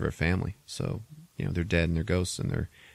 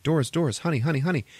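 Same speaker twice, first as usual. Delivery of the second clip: worried mom; 8 semitones higher, much faster than usual. Retold or imitated. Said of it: "she's like".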